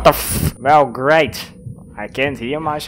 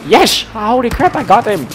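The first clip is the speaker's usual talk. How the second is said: excited win; 10 semitones higher, 6 dB louder than usual. Real, not acted. Lands a long sniper shot.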